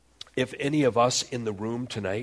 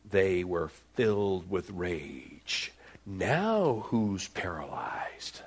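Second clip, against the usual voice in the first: slow, low whisper; hushed; 3 semitones lower, much slower than usual. Real, not acted. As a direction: drop to a pointed whisper. Preacher hushes for irony.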